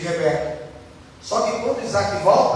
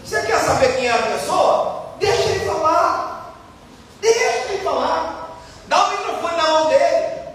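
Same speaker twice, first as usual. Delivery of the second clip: higher, insistent elder's voice; old pastor's challenge; 7 semitones higher than usual. Retold or imitated. Preacher voicing Pastor Antônio Gilberto to the church, higher and insistent. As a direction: demand they hand over the microphone. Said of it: "E ele falou assim".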